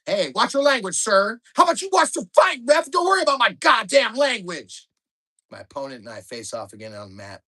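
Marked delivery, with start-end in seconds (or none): 0.0-1.4 raised, higher warning; stern ref. Retold or imitated. Ref scolds the dad. 1.5-4.9 high, loud snarling shout; dad screaming down the referee; furious dad. Retold or imitated. Dad shouts back at ref.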